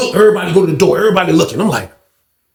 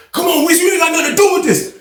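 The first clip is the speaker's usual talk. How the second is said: high shout pushing him off; retold shout; 13 semitones higher, faster than usual. Old head yelling at [name] to stay out of it, a higher shout. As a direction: yell it over the scuffle.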